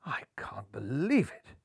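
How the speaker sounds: whispered in disbelief; shocked whisper. Acted. Skipper finding the stowaway, in a shocked whisper. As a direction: breathe it out in shock. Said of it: "gasped a shocked Golden Hair".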